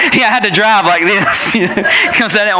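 talking through laughter; laughing. Retold or imitated. Son retelling driving his sister to school, laughing. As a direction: chuckle through the words. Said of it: "he was laughing so hard".

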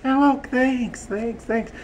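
high puppet voice; lightly squeaky character voice; Audrey. Acted. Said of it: "Here's my Audrey".

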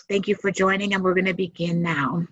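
ordinary talk

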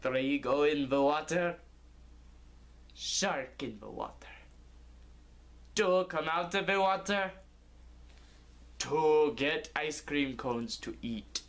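lightly voiced hungry fisherman; half-sung fisherman's rhyme; gruff fisherman. Fisherman muttering to his mate about ice cream, lightly voiced. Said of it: "one of the fishermen said".

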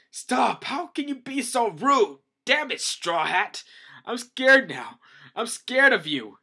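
high, loud frantic yelling; panicked scolding shout. Acted. Pappag scolding Straw Hat for rudeness, loud panicked yelling.

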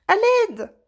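appel au secours aigu et fort; cri étouffé. A narrator's reading. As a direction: crie à l'aide, coincé. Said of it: "c'était un cri étouffé". (cri de détresse aigu)

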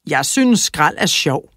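lightly voiced old junk collector; a hint of an odd old man delighting in trash; old junkman. A narrator's reading. Sigurd Skrot passing the children, lightly voiced old man. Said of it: "sagde Sigurd Skrot".

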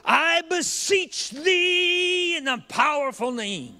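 high, loud, slow hammed-up preacher prayer; mock grandiose prayer. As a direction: overblown holy-roller prayer. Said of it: "He doesn't even add syllables".

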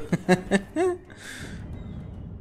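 high, soft giggle; light snicker, trailing on; gloating laugh. Real, not acted. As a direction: titter over the beaten foe. Laughs at the beaten enemy.

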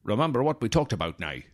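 mock Sean Connery doing Irish; a Scottish actor's shaky go at an Irish accent; attempted Irish accent. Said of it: "where he plays an Irish cop".

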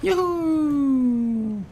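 long, very high-pitched yoo-hoo; high whoop. Real, not acted. Gamer whooping mid-match, very high drawn-out yoo-hoo. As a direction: sing out a long falsetto whoop.

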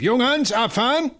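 high, ringing call across the yard; booming archangel voice. Acted. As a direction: call it out with a mighty voice. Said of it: "mit gewaltiger Stimme über den Hof".

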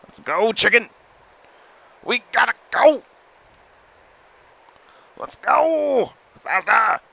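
high, loud urging; rally the chicken, high and loud; excited cheering. Real, not acted. Player urging his chicken to run, loud and high.

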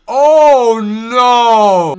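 very loud, high drawn-out cry; alarmed shout. Real, not acted. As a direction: wail it out in alarm. Scare in the game.

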